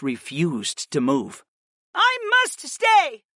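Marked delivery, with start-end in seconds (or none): 1.9-3.2 high, slow, emphatic shout; defiant shout back. A narrator's reading. Warrior to her friend, shouting back. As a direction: shout the refusal back, pitched way up. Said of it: "the blonde warrior shouted back at her friend".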